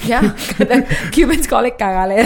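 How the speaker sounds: loud laughing through words; amused at Cuban slang. Real, not acted. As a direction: laugh while explaining the word.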